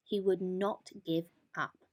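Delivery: ordinary delivery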